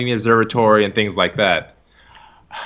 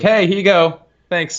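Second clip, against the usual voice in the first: casual handover; 7 semitones higher, 3 dB louder than usual. Retold or imitated. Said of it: "It's like".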